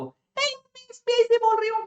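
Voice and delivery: sweet fake lady from the bank; man doing a woman's voice. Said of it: "लड़की की आवाज में phone करा जाएगा".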